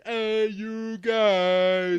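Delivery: stretched-out, high, loud bellow; big drawn-out Goonies yell; Sloth from Goonies. Retold or imitated. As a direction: howl it slowly like Sloth calling his friends. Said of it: "I always think Goonies".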